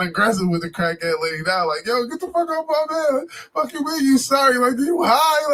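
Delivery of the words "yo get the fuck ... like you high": mock angry yelling. Kids yelling at the lady.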